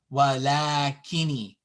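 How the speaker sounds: walakini with a long la; long vowel. Shown as a demonstration. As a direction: hold "laa" in walakini. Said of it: "as they sound like".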